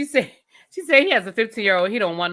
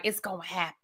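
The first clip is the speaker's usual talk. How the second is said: a soft, knowing mama, much quieter; mama's quiet certainty; 4 semitones lower, 11 dB quieter, faster than usual. Retold or imitated. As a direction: murmur it like a sure mother. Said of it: "Mama was like".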